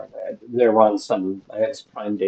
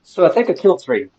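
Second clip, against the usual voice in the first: loud, higher rush of relief; breathless excitement; 8 semitones higher, 8 dB louder than usual. Real, not acted. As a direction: still pumped after the fight. After escaping the fight.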